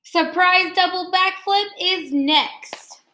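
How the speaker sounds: expressive picture-book read-aloud; reading to children. Acted. Teacher reading Jabari Jumps to her class, expressive read-aloud. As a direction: read the story warmly to the class, pausing on each step.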